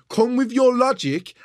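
half-shouting, drawn out and emphatic; loud, slow insistence. Real, not acted. Insisting to his partner she argue with logic, loud and slow. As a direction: raise the voice and slow down, spelling out your demand. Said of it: "I've got my point".

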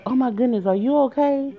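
high-pitched retold alarm; alarmed concern. Retelling the alarmed woman's reaction.

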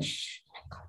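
hushed whisper, imitating someone shushing. Hushing a mother at the temple, in a whisper. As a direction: whisper it like a scandalised relative hushing you in a temple.

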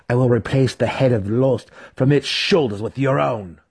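spat threat. A narrator's reading. Driz threatening the drow who stole his kill, spat. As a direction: spit out the threat, voice changed. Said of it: "he spat".

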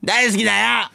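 put-on very high, crude child-star caricature; 芦田愛菜. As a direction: squeak it out as a fake little girl. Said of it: "芦田愛菜ちゃん、". (芦田愛菜のものまねのものまね、甲高く)

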